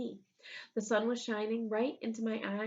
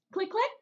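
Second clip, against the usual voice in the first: stapler clicks; 11 semitones higher, 5 dB louder than usual. Acted. Stapler sound in story.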